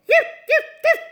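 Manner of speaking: very high, loud yips; piercing lapdog yelps; loud dog bark. A narrator's reading. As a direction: yap loudly like a small dog. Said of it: "Andrew barked loudly".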